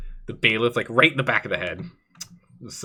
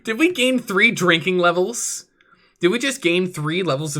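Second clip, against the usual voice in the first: delighted surprise; 6 semitones higher than usual. Real, not acted. Streamer delighted by level up.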